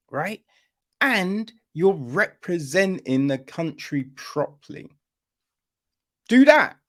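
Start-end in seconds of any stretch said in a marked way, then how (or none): 6.3-6.8 loud, high, punched command; shouted demand. Real, not acted. Comic yelling at the network to do it. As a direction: bark it at the network.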